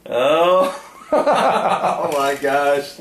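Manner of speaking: slow laughing oh my gosh; long laughing oh. Real, not acted. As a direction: crack up at the memory. Recalling a funny story.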